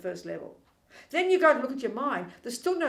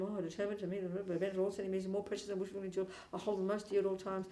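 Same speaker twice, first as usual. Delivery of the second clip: casual rattling off; 8 dB quieter, faster than usual. Retold or imitated. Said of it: "We just read these words so casually".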